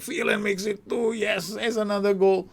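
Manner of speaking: high-pitched, jubilant goal cry; excited goal call. Real, not acted. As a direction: celebrate the goal at a soaring pitch. Calling a goal as it happens.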